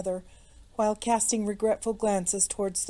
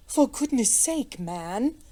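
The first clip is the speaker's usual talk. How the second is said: higher, exasperated lordly rebuke; admonishing; 3 semitones higher, slower than usual. A narrator's reading. A lord scolds the flustered innkeeper, admonishing. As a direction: scold him, pitched up at first. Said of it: "admonished his lordship".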